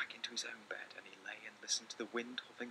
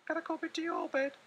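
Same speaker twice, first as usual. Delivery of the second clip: much higher pleading little voice; little mouse asking; 6 dB louder than usual. Acted. Little Mouse asking Big Mouse to share his bed, high small plea. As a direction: beg like a tiny mouse. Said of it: "asked Little Mouse".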